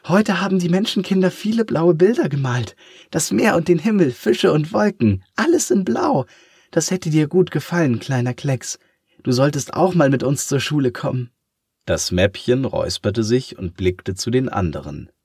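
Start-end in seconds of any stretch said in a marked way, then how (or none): 0.1-11.3 much higher, fast and bubbly; eager eraser voice, quick and bright; merrily chattering. Acted. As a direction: rattle it off full of joy. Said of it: "fuhr munter fort".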